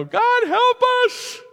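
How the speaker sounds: high, slow, hammed-up cry of God, help us; half-joking plea on a plane; mock plea to God. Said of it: "do not stand up and go".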